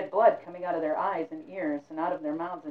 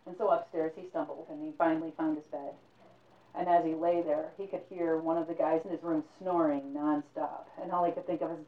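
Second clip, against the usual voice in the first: ordinary delivery, nothing marked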